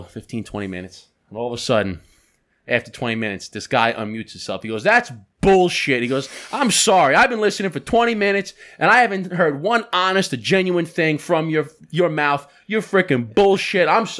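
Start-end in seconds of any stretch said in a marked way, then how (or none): 4.8-6.0 loud, drawn-out indignant outburst, higher pitched; mimicked angry yell. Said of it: "yelling at me over the phone". 6.5-14.2 high, loud, indignant customer tirade; mimicked angry rant. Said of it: "yelling at me over the phone".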